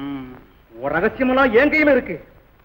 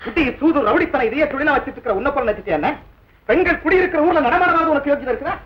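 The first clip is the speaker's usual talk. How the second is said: normal talking voice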